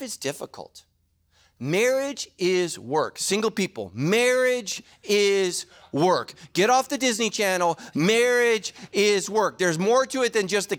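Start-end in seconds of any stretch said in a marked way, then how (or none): 1.6-9.6 each "Marriage is work" drawn out and weighted; slow repeated refrain. Acted. Pastor warning single people, slow and heavy. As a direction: lean on "Marriage" every time.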